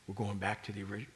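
normal talking voice

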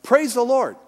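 high, loud cry of praise; cried out loud. Retold or imitated. Neighbor woman calling from her porch, loud praise. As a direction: call it out to the heavens. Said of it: "she would cry out loud".

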